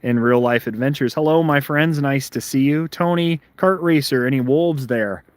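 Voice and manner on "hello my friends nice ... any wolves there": ordinary talk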